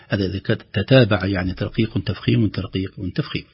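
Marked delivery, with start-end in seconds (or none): none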